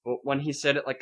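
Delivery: ordinary talk, nothing marked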